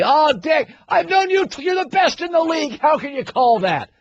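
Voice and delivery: hammed-up coach flattering then complaining; mock pleading coach. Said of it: "They poke and prod the refs".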